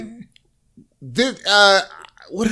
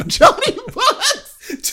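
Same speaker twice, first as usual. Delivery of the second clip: drawn out through laughter; laughing; much slower than usual. Real, not acted. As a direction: say it while cracking up. Laughing while reading.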